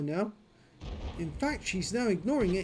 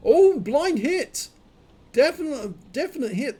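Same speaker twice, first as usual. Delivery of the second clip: thrilled shout over a lucky hit; bursting out with delight; excited; 6 semitones higher, 8 dB louder than usual. Real, not acted. Blind hit scored in replay.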